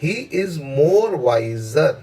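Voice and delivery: very slow, drawn-out reading of the sentence; very slow dictation. Shown as a demonstration. Teacher reading a test sentence very slowly to students. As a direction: stretch every word for the class. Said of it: "as के बाद आप जोर लगाइए".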